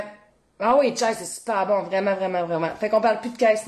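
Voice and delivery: normal talking voice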